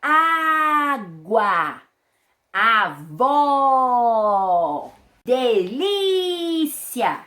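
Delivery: "Água", "Avó", "Delícia" each said loud with the stressed vowel wide open. Shown as a demonstration. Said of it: "deixa a sílaba tônica com um som mais aberto".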